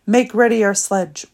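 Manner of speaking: loud, curt queenly command; commanding order. A narrator's reading. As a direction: give the order to a servant. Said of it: "ordered the witch".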